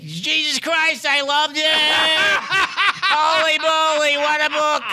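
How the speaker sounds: hammed-up Jerry Lewis raving, slow and laughing; excited Jerry Lewis. Jerry Lewis raving to Drew about the book, high and laughing. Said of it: "And he goes".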